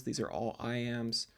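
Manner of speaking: ordinary talk, nothing marked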